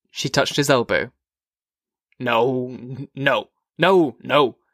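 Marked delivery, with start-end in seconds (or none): 2.2-4.6 said quickly. A narrator's reading. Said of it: "he said quickly".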